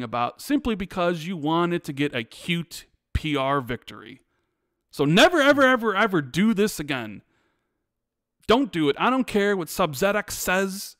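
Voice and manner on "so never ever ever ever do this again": louder, punching each never and ever; emphatic never ever. Real, not acted. Host scolding Xbox over the delay, stressing each ever. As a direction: hammer every ever home to Xbox.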